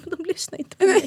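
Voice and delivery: high-pitched plaintive retort; exasperated protest. Retold or imitated. Mum answering partner's remark about her shouting, exasperated protest.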